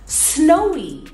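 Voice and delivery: put-on animated, drawn-out kids' lesson voice; teacher to young children. Shown as a demonstration. Teacher leading a weather game for children, animated. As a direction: sing out the words playfully to little learners.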